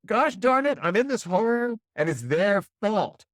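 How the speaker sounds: exaggerated high, loud self-pity, slowed down; mock complaint. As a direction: act out the blamer stuck in the hole. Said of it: "is what we say".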